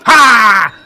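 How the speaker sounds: a short loud bark of a laugh; mock Jedi shout. Host imitating a Jedi's bark to his co-host, loud. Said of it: "Jedi who goes".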